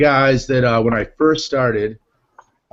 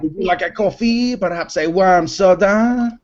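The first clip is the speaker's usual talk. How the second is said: put-on French guy accent; 7 semitones higher than usual. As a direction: play a mock French server offering drinks. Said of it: "I call it French guy Fridays".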